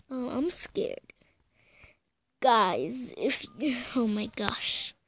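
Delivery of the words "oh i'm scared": soft frightened whisper; hushed fear; scared whisper. Real, not acted. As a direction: whisper it nervously.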